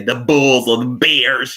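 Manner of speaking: slow imitated Chicago sports-fan accent. Quoting those guys from commercials, Chicago accent. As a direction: draw it out in a light Chicago accent. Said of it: "They talk like that".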